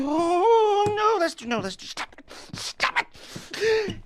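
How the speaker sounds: high, crying protest that cracks into a hush; tearful pleading. Acted. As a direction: plead through tears, then beg them to stop. Distressed pleading outburst, drugged.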